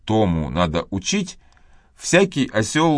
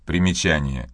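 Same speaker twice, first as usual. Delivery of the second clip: usual speaking voice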